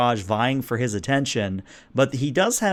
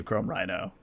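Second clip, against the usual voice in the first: ordinary talk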